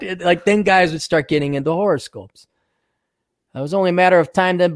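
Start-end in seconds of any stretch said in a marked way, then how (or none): none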